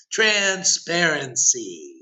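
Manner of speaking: very slow single word, set apart; drawn-out proclamation. Acted. Preacher to devotees, one slow emphatic word. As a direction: stretch the word out like a verdict.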